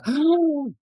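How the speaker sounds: awed gasp. Retold or imitated. New parent's awe.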